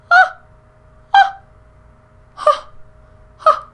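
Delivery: very high, loud squeals; delighted squeal. Real, not acted. Squealing over the highlight.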